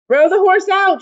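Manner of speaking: high, loud jeering shout; loud high angry heckle. A narrator's reading. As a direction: heckle from the stands. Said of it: "shouted someone".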